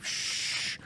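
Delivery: long unvoiced-breath shush; soft, drawn-out shushing sound; hushing to sleep. Streamer pretending to put a ranting gamer to sleep, soft hush. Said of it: "I'd be like".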